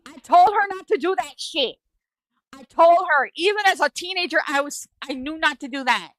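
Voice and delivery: high, loud protest; exasperated insistence. Real, not acted. Storyteller protesting that mom was warned not to, exasperated. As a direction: insist emphatically that the warning was given. Protests mom's dumping plan, vindicated.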